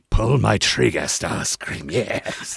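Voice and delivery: low menacing robot tyrant, trailing off; robot villain voice. Pretend. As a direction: growl it like a villainous robot leader. Transformers voices bit replying.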